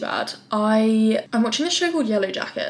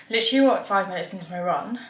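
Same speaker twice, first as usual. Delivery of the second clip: ordinary talk, nothing marked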